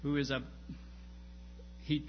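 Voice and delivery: ordinary talk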